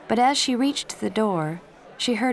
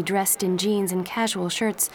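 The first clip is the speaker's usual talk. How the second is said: ordinary delivery, nothing marked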